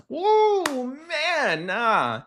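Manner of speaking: stunned high-pitched whoa, man; shocked whoa. Real, not acted. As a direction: react like you can't believe what you heard. Reacting to the manager's line.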